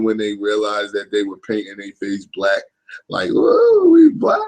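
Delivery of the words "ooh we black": very high, loud gleeful squeal; hammed-up joyful falsetto whoop; mock delight. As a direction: imitate people thrilled with themselves. Mimics people delighted at painted faces.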